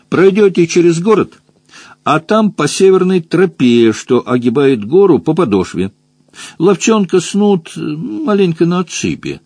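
high, lively rabbit local; rabbit giving directions. A narrator's reading. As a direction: point out the way in a high voice. (кролик объясняет дорогу высоким голосом)

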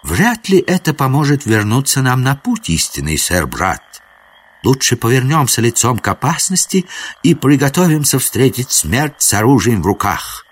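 high-pitched knightly counsel; lofty prince, resolute. Acted. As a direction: urge a noble last stand in courtly tones. Prince urges noble stand.

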